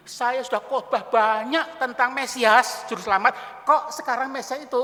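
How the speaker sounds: loud, stretched-out protest; acted aggrieved outcry; indignant complaint. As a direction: protest loudly, drawing out the key words. Imagined complaint of John in prison.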